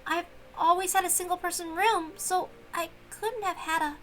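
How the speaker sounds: put-on shy maid talking to her old friend; timid young maid.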